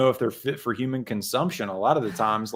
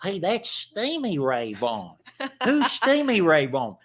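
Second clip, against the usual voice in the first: cartoon-kid voice rising higher on the question; South Park voice; 4 semitones higher than usual. Host imitates a South Park scene, cartoon voice. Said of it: "he's like South Park".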